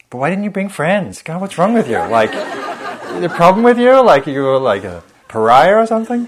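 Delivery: scolding critic. Acted. Inner critic berating the hiker for coming alone, loud high mock scold. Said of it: "argue both sides".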